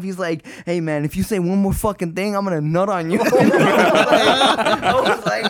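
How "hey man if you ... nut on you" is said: ordinary talk, nothing marked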